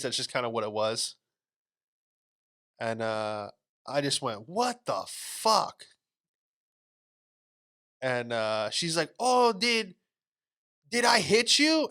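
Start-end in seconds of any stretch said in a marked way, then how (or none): 4.5-5.8 high, drawn-out retold outburst; stunned disbelief. Acts out reaction to being hit. 9.2-11.9 high-pitched imitation of a small woman; mock apologetic lady. Voices small lady who hit him.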